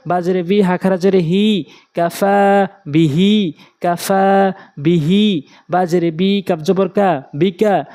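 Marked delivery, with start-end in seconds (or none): 2.0-5.4 slow কাফা বিহি, ফা and হি held; drawn-out madd. Shown as a demonstration. Teacher applying madd in words, drawn-out endings. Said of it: "প্রয়োগ করে পড়ব".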